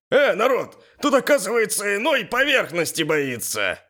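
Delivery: high, jeering goading voice; loud taunting. A narrator's reading. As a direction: egg him on for everyone to hear. Юрий publicly taunts the hero. (громко подначивая, с издёвкой)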